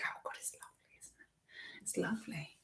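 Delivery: hushed, breathy whisper; soft delighted whisper. Real, not acted. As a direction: whisper it like a cosy secret. Whispering about cosy bed.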